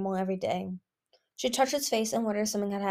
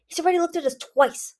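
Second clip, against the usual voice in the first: urgent, higher-pitched retort; hissed retort; 8 semitones higher, 6 dB louder than usual. A narrator's reading. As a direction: hiss it back, tense and raised. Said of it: "Listener hissed back".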